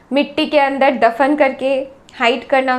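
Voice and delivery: normal talking voice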